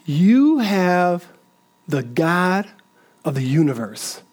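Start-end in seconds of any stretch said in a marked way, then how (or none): none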